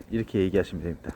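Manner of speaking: usual speaking voice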